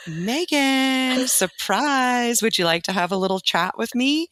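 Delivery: a hint of a welcoming hostess springing a surprise; sweet hostess voice. First lady surprising the teacher, lightly voiced sweet hostess.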